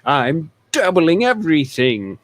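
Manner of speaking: loud, high greedy boss; greedy exec voice. Pretend. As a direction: shout it like a smug tycoon. Joins the greedy exec bit.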